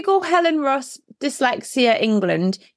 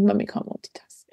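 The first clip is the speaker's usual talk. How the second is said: whisper; 4 semitones lower, 5 dB quieter than usual. Retold or imitated. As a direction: whisper "Mummy can't multitask" under the breath. Repeats son's phrase, whispered.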